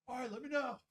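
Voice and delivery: high, soft put-on voice for his friend; imitating buddy. Buddy asking if he's ready to time him, high soft mock voice. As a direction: pitch it way up and hush it.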